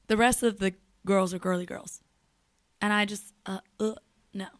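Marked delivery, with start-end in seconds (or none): none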